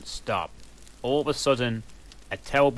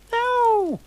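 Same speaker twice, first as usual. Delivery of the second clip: much higher, louder scream; 7 dB louder than usual. A narrator's reading. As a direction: scream out in horror. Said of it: "I screamed sexily".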